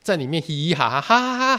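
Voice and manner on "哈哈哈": high mock girlish giggle; imitated giggling. Imitating the girls giggling in the air-conditioned house, high mock laugh. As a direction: mimic the girls laughing inside. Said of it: "好开心的那种声音".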